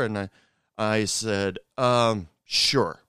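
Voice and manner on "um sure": halting, emphatic mock agreement; reluctant, hesitant agreement. Bartender asked to pass on a love message, reluctant agreement. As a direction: agree with an obvious pause of doubt. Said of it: "I didn't say it in that way".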